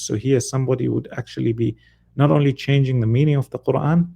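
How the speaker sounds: ordinary talk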